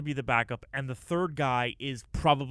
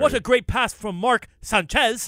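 higher, louder, slow hammed-up play-by-play; mock announcer; 7 semitones higher, 7 dB louder, slower than usual. Co-host imitating Jaworski's Monday night call. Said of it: "mocking Jaworski's broadcast call".

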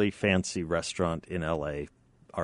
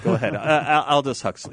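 ordinary talk

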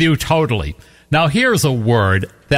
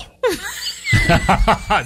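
laughing fit; 13 semitones higher than usual. Real, not acted. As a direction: crack up laughing uncontrollably. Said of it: "You wouldn't even get it out without laughing".

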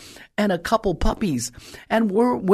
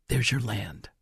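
hushed divine whisper, much softer and lower; 7 semitones lower, 5 dB quieter, slower than usual. Acted. Preacher voicing the Holy Spirit's word to her, as a whisper. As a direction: breathe it like a secret voice from heaven.

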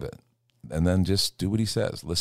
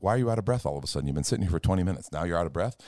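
teasing fast; much faster than usual. Retold or imitated. Mocking a sudden busy act.

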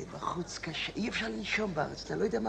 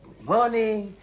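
higher, louder greeting, imitating the British; polite British soldier; 5 semitones higher, 6 dB louder, much slower than usual. Old man imitating British soldiers at a checkpoint, politely. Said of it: "היו אומרים".

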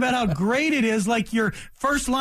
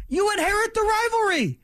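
exasperated; 10 semitones higher, faster than usual. Acted.

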